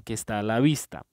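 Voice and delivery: ordinary talk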